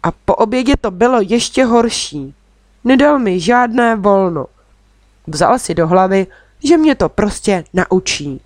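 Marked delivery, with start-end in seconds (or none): none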